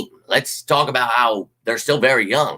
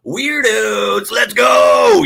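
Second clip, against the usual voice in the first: much higher and louder let's go; mocking rally cry; 10 semitones higher, 6 dB louder, much slower than usual. Real, not acted. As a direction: dismiss the haters, then rally. Mocks haters then rallies.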